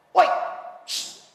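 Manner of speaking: put-on sharp hissed shush then loud high shout at kids; angry caretaker shouting. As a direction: chase the kids off, barking in bursts. Tok Siak marah budak-budak. (penjaga masjid menengking budak-budak)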